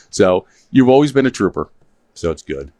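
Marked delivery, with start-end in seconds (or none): none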